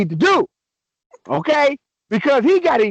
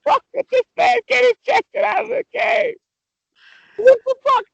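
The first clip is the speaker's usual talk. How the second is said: laughing outburst; 13 semitones higher than usual. Real, not acted. Outburst at the ejection.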